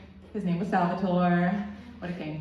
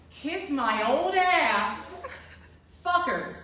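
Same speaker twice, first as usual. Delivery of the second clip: high, drawn-out mock yell of an old lady; Greta; 6 semitones higher, much slower than usual. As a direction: holler it slowly as a defiant old woman, pitch pushed up. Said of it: "I'm gonna do the voice".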